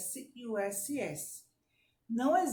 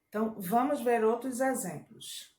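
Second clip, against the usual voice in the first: normal talking voice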